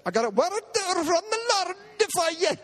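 put-on half-done Polish lady's accent. Polish lady after the meeting announcing a word, mock Polish accent. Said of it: "Polish lady".

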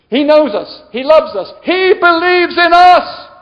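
loud and high, building to a shout; impassioned preaching. Real, not acted. Preacher assuring the congregation, building to a shout. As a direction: proclaim it harder with each phrase.

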